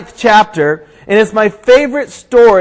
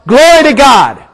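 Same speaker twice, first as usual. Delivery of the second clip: raised, louder storytelling shout; acted shout; 4 semitones higher, 5 dB louder than usual. Rider trying to stop dogs near a cliff, acted shout. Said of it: "So he shouts".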